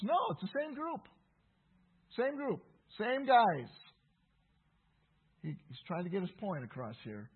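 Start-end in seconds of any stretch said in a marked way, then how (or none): 0.0-3.7 high-pitched, insistent correction; firm contradiction, punching the repeated word "Same"; emphatic rebuttal. Real, not acted. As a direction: knock down the objection, pitched up. Rebutting an imagined objection.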